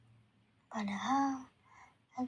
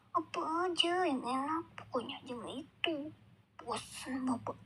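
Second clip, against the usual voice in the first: mild shout; 4 semitones higher than usual. Acted. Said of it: "jawab Bapak sambil teriak".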